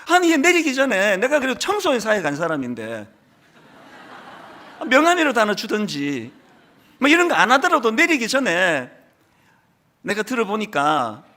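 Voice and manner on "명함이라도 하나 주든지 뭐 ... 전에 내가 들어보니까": normal talking voice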